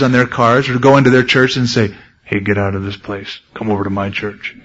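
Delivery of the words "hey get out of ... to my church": low, quiet mocking voice; a hint of a pushy rival pastor; mock pushy preacher. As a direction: mutter it like a cheap recruiter. Mocking a sheep-stealing preacher.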